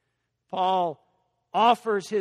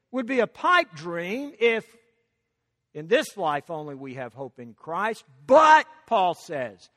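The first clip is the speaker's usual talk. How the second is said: ordinary talk, nothing marked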